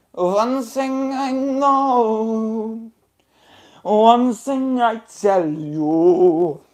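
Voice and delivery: slow, stretched-out menacing chant; theatrical Darwin threat. Acted. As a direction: draw each phrase out grandly. Villain acted as drawn-out sung threat.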